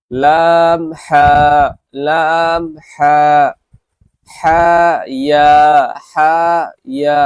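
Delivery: each letter name drawn out slowly and loud; letter drill. Shown as a demonstration. Teacher models letter pairs for students to repeat, drawn out. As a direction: sing out জিম and দাল long and clear. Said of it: "আমি দুবার পড়ব".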